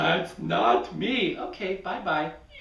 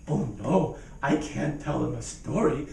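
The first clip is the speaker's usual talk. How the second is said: ordinary delivery, nothing marked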